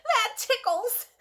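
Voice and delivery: high, slow ticklish squeal; giggly Larry. Acted. Larry being tickled by the children, giggling.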